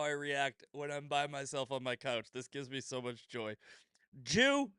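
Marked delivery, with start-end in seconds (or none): 4.2-4.7 higher and louder, delighted yell; gleeful shout. Pretend. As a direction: shout it with glee. Repeats insult gleefully.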